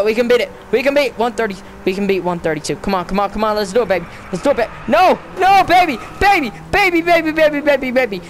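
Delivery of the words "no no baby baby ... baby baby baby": higher frantic pleading, fading at the end. Real, not acted. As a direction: beg the car to make it. Begging his car during race.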